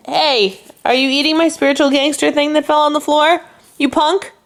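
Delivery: scolding the dog. Real, not acted. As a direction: tell off a naughty pet. Scolding dog eating something.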